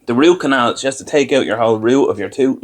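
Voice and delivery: usual speaking voice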